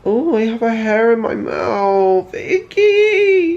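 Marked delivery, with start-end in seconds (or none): 2.3-3.6 squeaky icky in disgust; high disgusted squeal. Real, not acted. Disgust at hair.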